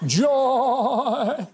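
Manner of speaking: high, warbling singsong "joy"; long, high vibrato of fake elation; mock joy. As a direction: belt it out in sarcastic, quavering rapture. Mocks convention filled with joy.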